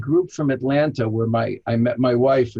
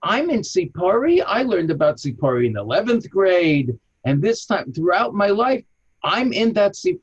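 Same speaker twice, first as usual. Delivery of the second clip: excited dawning realization, much higher; 6 semitones higher than usual. Retold or imitated. Guide retelling his own excited realization, higher. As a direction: let it click, voice rising high. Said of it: "I said".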